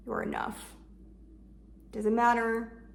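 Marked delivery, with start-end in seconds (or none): 0.1-0.7 soft, almost whispered reassurance; tender self-talk. Shown as a demonstration. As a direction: breathe it to yourself kindly. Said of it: "I need to be told from myself".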